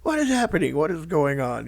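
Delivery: retold bewildered outcry, high and loud at the start; high-pitched mock bewilderment; Jack Septiceye. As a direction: blurt it out as a baffled friend, pitch dropping. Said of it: "they called Jack up".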